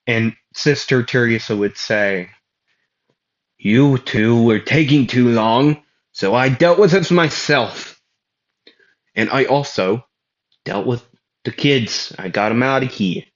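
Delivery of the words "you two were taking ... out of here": put-on a light New York accent for the nun; brisk New York accent, handling it herself. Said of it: "She sounds like a New Yorkan".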